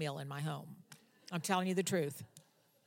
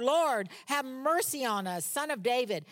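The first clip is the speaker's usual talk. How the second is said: crying out louder; 5 semitones higher, 4 dB louder than usual. Retold or imitated. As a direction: shout it over the crowd. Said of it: "they cried out more".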